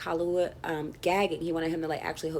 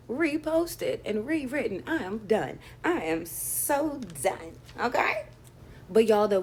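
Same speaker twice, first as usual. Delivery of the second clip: slow, high sassy drawl; put-on accent; 4 semitones higher, slower than usual. Real, not acted. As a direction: stretch it out dramatically. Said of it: "I don't know where that accent just came from".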